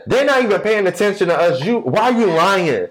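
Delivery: high, rapid mock accusation; drunk woman accusing. Drunk woman accusing her man of lying, high indignant voice. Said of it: "The girl was sauce".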